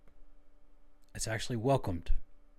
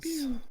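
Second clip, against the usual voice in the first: pew pew zaps. Pretend. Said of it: "Finger guns".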